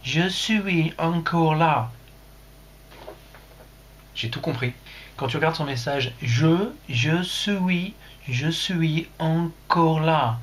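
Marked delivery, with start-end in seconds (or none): 0.0-1.9 slow, exaggerated English-accented French. Streamer reading out Jean-Daniel's message, English accent. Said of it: "Tu es anglais, Jean-Daniel". 6.2-10.4 put-on very drawn-out French in a British accent. Streamer showing how Jean-Daniel's message reads, British accent. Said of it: "son bel accent, euh, britannique".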